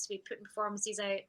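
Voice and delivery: usual speaking voice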